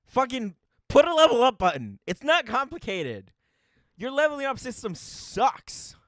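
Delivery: yelling, furious at the app; angry rant. Real, not acted. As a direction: shout the complaint up high and loud. Ranting at D&D Beyond.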